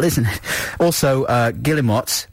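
normal talking voice

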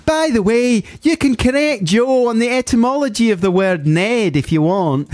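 high, emphatic caricature Glaswegian; Glaswegian accent. Acted. Host reading listener's correction to co-host, Glaswegian accent. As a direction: lay on a broad, high Glasgow accent. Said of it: "I should do the correct accent".

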